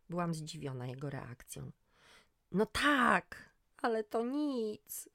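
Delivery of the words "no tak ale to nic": slow, higher breaking voice. Acted. Injured son tells mother it's nothing, voice breaking. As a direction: hold back tears. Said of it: "Głos się mu załamał".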